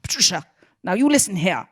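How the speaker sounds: loud, imperious British impersonation; emphatic mock-British telling-off; Harold Pinter's British accent. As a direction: lay down the law in a British accent. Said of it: "That's a good British accent".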